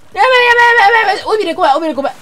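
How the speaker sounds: high, loud frantic chatter; real panic. Real, not acted. Player fleeing the shark's tail, chattering in real panic. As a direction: babble in fear as it closes in.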